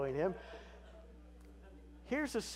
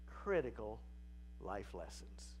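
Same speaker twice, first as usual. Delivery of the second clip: ordinary talk, nothing marked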